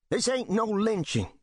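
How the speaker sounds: high, insistent call. Acted. Bill House calls to the crowd, insistent. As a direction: call it out to the men. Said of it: "Bill House calls".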